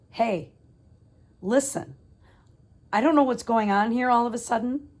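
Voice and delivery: loud, sharp opening on the phone; fired up. Retold or imitated. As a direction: demand an explanation, voice high and loud. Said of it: "now I was fired up".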